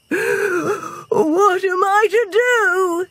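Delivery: high, drawn-out despairing wail; frightened wail. A narrator's reading. Benny slumps to the ground frightened, high slow wail. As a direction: wail it slowly, close to crying. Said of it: "he wailed".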